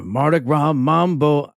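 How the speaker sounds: put-on slow, chanted Midwestern accent; drawn-out Midwestern imitation. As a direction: sing it out like a Midwestern lady. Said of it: "the most Midwestern voice come out".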